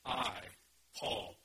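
"I, Paul" with a loud hit on each word and a pause between; emphatic self-naming. Acted. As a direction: punch "I", pause, then punch "Paul". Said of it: "That's em-, emphasis".

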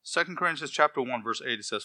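normal talking voice

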